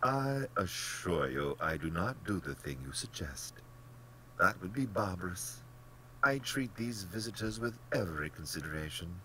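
lilting, genial reassurance; polished, pleasant general, lifting in pitch; most pleasant manner. A narrator's reading. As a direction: chide and reassure with airy pleasantness. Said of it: "said in his most pleasant manner".

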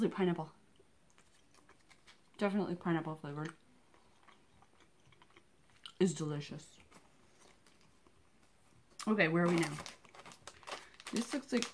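talking around gum; chewing gum while talking. Real, not acted. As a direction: mouth partly full. Seen 1: Puts candy in mouth at 1.2s, then talks while chewing.

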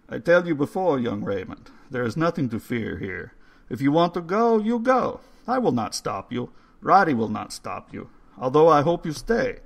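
put-on coaxing old man spooning soup. A narrator's reading. Old man's voice, held.

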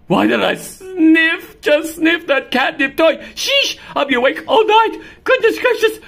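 high, loud laughing cat; the cat giggles, high on catnip; high giddy voice, half laughing. Acted.

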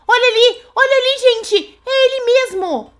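high frightened exclaiming; pointing him out in fright; alarmed. Real, not acted. Spots the monster again.

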